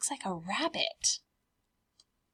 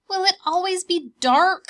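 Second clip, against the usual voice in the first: high, louder little cub asking; 10 semitones higher, 10 dB louder than usual. Acted. Bear cubs asking Mama, high child voice. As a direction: pipe up like a curious bear cub.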